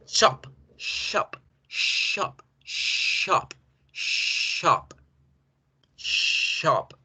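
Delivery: "shop" with a long hushed sh; thick SH. Shown as a demonstration. Teacher drills the word for students, long thick SH. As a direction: stretch the opening sh of "shop". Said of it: "ese sonido de la S gruesa".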